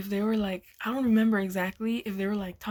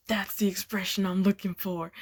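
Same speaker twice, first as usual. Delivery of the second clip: whispered excitement. Retold or imitated. Teacher excited about the video.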